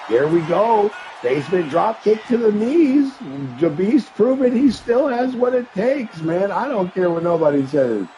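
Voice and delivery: excited play-by-play. Acted. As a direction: erupt at the big drop kick. Calling a big move live.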